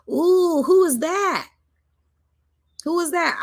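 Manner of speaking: high, excited gasp of discovery; surprised. Real, not acted. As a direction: exclaim as if you just spotted a face. Spots a face in the image.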